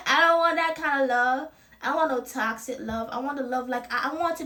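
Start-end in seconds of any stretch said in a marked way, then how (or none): 0.1-1.5 laughing brush-off. Pretend. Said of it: "be like".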